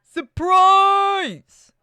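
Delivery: loud, very high, stretched-out cheer; gleeful hammed-up reveal; surprise shout. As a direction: yell it like springing a party.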